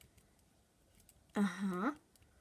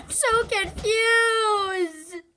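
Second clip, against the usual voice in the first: whiny wail; 7 semitones higher, 6 dB louder, much slower than usual. Real, not acted. Confused by the game.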